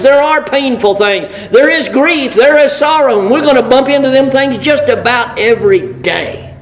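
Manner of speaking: a preacher turning grief into hope; preaching at full force. Acted. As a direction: lift the congregation with rising fervour.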